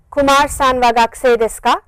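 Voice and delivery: firm voice, upturn on "ka"; rising question intonation. Shown as a demonstration. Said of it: "with a rising intonation".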